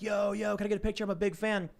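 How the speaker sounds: high-pitched eager fan, not really yelling; mock fan holler. Fans hollering at a famous guy, mock holler. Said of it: "hollering at you and yelling at you".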